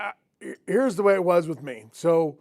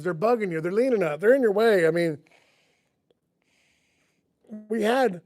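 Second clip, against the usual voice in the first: ordinary delivery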